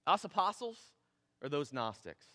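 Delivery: normal talking voice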